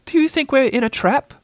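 high little worried question; nervous. A narrator's reading. Piglet fearing a trap, nervous. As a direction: ask timidly in a high voice. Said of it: "he went on nervously".

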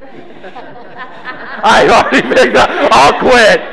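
slow words squeezed out through laughter; laughing. Real, not acted. Preacher backing off from picking on congregants, laughing. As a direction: give up mid-sentence, laughing.

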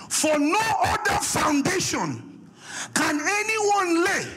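preaching. Acted. Preacher reads scripture aloud.

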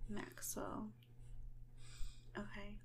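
whispering. Acted. Whispered name aside.

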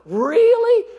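high, loud disbelieving really; incredulous shout. Real, not acted. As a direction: fire it back in disbelief. Preacher's incredulous outburst.

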